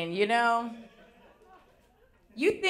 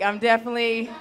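ordinary talk

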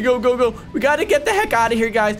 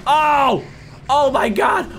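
usual speaking voice

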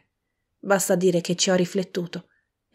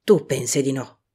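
with effort; 4 semitones lower than usual. A narrator's reading. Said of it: "Invece disse con sforzo".

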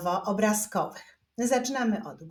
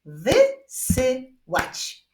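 very slow, louder separated syllables; word broken into spaced beats; 5 semitones higher, 5 dB louder, much slower than usual. Shown as a demonstration. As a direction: clap out each syllable firmly. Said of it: "Tym razem wyklaszczmy sylaby w wyrazie wysyłać".

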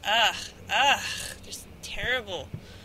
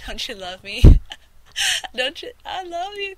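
playful pleading; 8 semitones higher, 12 dB louder than usual. Pretend. Teasing viewers.

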